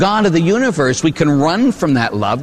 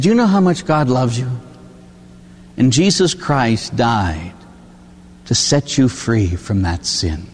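tender reassurance; 4 semitones lower than usual. Retold or imitated. Priest comforts ashamed penitent.

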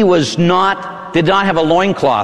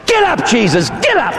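high, jumping higher on the repeat; acted harsh command; 9 semitones higher than usual. Soldier standing over fallen Jesus before kicking, high harsh command. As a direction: order the fallen prisoner up.

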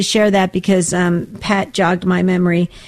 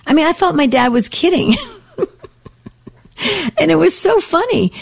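higher pitch, breaking into real laughter; tickled, laughing at the memory; amused laughing; 8 semitones higher than usual. Real, not acted. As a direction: tell it through a laugh. Laughing at dad's funny request.

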